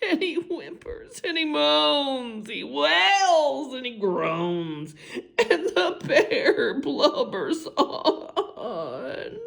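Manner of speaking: hammed-up whimpering telling; mock blubbering. Narrator telling of the crying bear, mock blubbering. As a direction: blubber through the words.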